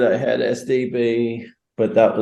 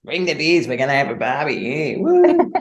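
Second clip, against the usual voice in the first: over-the-top Australian bloke, higher and whooping; rowdy Australian party voice with a high whoop; exaggerated Australian accent calling for beers; hammed-up Aussie accent; 7 semitones higher than usual. Shown as a demonstration. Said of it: "That sounded very Australian".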